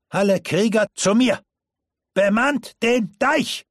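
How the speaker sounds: high, loud battle order to the warriors; thundering command. Acted. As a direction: bellow it across the dike like a commander. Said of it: "mit donnernder Stimme".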